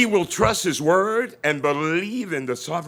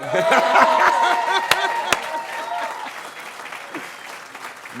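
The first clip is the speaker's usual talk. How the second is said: loud laugh; 4 dB louder than usual. Real, not acted. Laughing at own joke.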